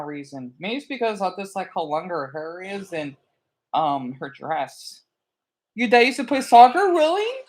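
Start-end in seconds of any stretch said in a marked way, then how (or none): 5.8-7.4 surprised. Real, not acted. Reacting to chat message.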